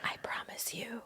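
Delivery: imitating a spooky whisper. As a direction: breathe it like a secret. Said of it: "I thought you were going".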